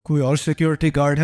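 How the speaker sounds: ordinary talk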